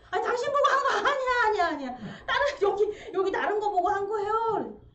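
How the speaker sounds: flustered denial. Acted. Bystander protests innocence, acted.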